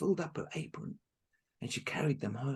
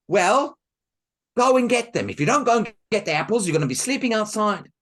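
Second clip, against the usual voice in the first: loud, higher threat; threatening stepmother; 7 semitones higher, 13 dB louder than usual. Acted. Stepmother threatening Marushka, loud and harsh. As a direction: threaten her sharply.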